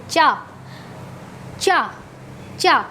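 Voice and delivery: चा with the tongue on the palate; palatal sound. Shown as a demonstration. Teacher shows learners where the tongue lands, palatal चा repeated. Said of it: "तो तालु में".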